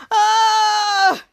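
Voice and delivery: long, loud, held "ah"; high drawn-out mock scream; startled cry. Goomer reacting to the body swap, loud startled cry.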